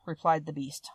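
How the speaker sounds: plain reading voice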